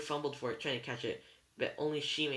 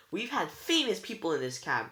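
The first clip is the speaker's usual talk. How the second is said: higher and louder, proud outburst; excited exclamation; 6 semitones higher, 5 dB louder than usual. A narrator's reading. Old cab driver boasting to passengers, excited exclamation. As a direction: exclaim it with a lift in pitch. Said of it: "Anger exclaimed".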